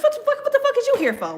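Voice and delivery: high, fast, indignant outburst; mock outraged. Telling off an unwanted person, high indignant outburst. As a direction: fire it off high and fast, fed up.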